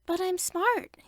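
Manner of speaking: put-on a small boy digging in his heels; child pushing back at his mother; insistent child. A narrator's reading. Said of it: "he insisted".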